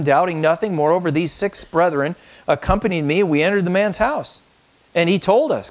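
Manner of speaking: scripture reading. Acted. Pastor reading scripture aloud to the congregation, proclaiming. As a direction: read the verse out as a proclamation.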